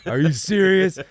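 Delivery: high and loud exasperated rant; retold yelling at son.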